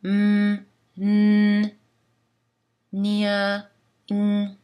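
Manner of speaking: humming each nasal m, n alone; isolated nasal consonants. Shown as a demonstration. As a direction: hold each nasal sound clearly. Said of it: "Les consonnes nasales du français sont". (consonnes nasales isolées)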